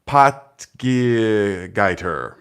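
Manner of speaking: "Potgeiter" sounded out very slowly, POT-guy-ter syllable by syllable; careful name attempt. Shown as a demonstration. Host reading a viewer's name, slowly sounding out "Potgeiter". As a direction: feel your way through "Potgeiter" one syllable at a time. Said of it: "I've never said that last name".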